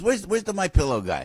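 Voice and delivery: calling across the room as Trump; host playfully quoting the president calling for Mike; imitating the president. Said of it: "he calls you and he goes".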